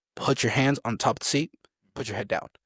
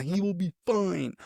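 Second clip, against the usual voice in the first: screaming woman, imitated; 8 semitones higher than usual. Female sergeant insists the fainted recruit is fine, imitated high scream. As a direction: imitate a mild, drawn-out high yell. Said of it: "Some female screaming out".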